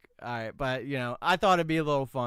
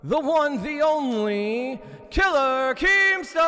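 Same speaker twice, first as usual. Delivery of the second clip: big booming stage announcer; announcer hype intro; 7 semitones higher, 8 dB louder than usual. Acted. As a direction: announce the star like a boxing MC. Hype intro over music.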